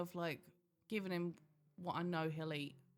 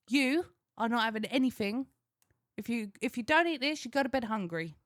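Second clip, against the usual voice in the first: stern parent; 5 semitones higher, 5 dB louder than usual. Retold or imitated. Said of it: "I went".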